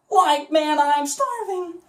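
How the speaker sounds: Shaggy again, high; high goofy stoner line. Shown as a demonstration.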